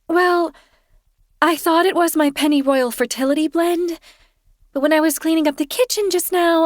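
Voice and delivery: high, sheepish confession voice; Kirsten sheepish. A narrator's reading. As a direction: voice her high and apologetic.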